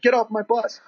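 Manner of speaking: high-pitched, curt order; mock indignant command; retold angry driver. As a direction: act out a driver throwing someone off. Bus driver ejects bleeding passenger.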